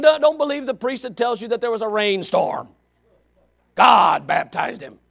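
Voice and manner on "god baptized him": slow, loud, deliberate; emphatic proclamation. Real, not acted. Preacher declaring it to the congregation, slow and loud. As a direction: land each word slowly.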